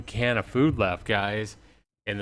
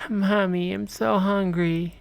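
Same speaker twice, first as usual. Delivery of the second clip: put-on high whiny kid voice, slow; hungry child; 11 semitones higher, slower than usual. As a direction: whimper like a hungry little kid. Child again begging.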